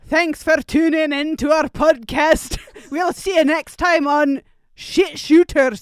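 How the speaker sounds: high, loud, slow Scottish sign-off; Scottish accent. Shown as a demonstration. Cohost signing off the podcast on request, hammed-up Scottish. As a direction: push a big drawn-out Scottish accent. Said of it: "I wanna hear this".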